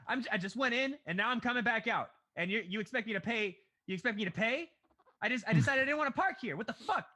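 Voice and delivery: loud, high-pitched outraged customer tirade; indignant rant. Acted. Moviegoer acting out Buscemi's argument with the parking attendant, indignant rant. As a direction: act out an escalating protest, loud and high.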